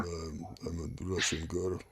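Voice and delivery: low-key impression of a mannish Russian girl; mock manly voice. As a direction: play a deep-voiced Russian woman, in jest. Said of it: "she has the most manly voice".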